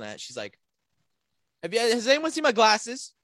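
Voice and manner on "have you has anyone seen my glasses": raised, higher voice, imitating a teacher; mock teacher asking the whole room, loudly; loud, pitched-up impersonation of an annoyed teacher; calling out to class.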